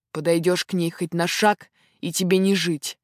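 usual speaking voice

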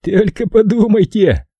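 slow, high mocking singsong; nasty singsong. A narrator's reading. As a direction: sing it out with a nasty smirk. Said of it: "пропел Кирк с мерзкой улыбочкой". (нараспев, с мерзкой улыбочкой)

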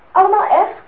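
high, loud astonished question; a gasp of disbelief at the paintings; amazed. Acted. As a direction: marvel at the paintings.

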